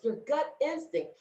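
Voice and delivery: ordinary delivery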